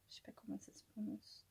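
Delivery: a self-conscious aside, whispered. Real, not acted. Admitting she can't pronounce it in a whisper. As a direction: drop to a breathless whisper.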